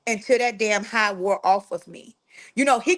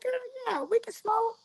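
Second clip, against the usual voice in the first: younger self; 12 semitones higher, 6 dB quieter than usual. Retold or imitated. Her own younger self agreeing eagerly.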